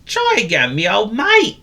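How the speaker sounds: high, cheery call; encouraging. Acted. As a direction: cheer a clumsy friend on. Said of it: "encouraged Willy Woof".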